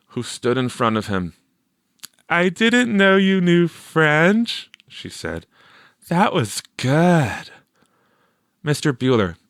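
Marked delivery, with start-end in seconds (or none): 2.3-4.7 put-on girl's impressed voice. A narrator's reading. Teresa speaking to Victor. 6.1-7.5 put-on girl's praise. A narrator's reading. Teresa praising Victor.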